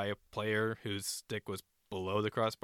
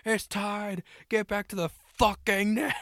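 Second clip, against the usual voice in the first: much higher, loud frantic yell; retold screaming; 10 semitones higher, 5 dB louder, slower than usual. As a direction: scream him back to his net. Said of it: "he's screaming".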